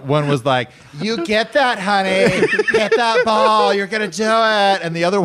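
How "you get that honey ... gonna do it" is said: high, put-on cheering voice; mock encouraging heckler. Crowd member encouraging batter, high mock cheer. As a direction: sweetly cheer on the batter in a higher voice. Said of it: "like, the encouraging stuff".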